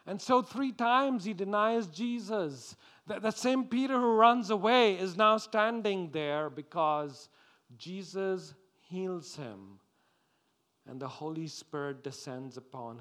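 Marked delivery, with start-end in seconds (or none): 0.1-9.8 high, loud, impassioned preacher; fervent pulpit delivery; full-force preaching. Acted. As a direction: drive the story home to the congregation with raised voice.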